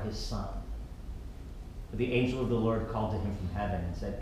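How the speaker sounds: soft, measured Bible reading; scripture reading. Acted. Pastor reading Genesis aloud to the church, soft reading. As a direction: read the passage gently to the congregation.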